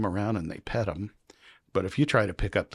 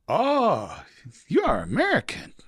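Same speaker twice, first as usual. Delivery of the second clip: much higher put-on officer voice; imitating the officer; 11 semitones higher, slower than usual. Immigration officer teasing the American traveler, high put-on voice. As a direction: play the passport officer, pitched way up and sly.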